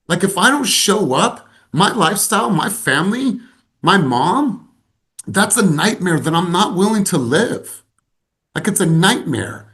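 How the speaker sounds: impassioned. Real, not acted. Coach urging her team in an impassioned voice. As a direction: drive home the fear of not acting.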